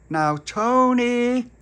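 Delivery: high, slow, drawn-out call; calling through letterbox. Acted. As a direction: sing out the name through the door.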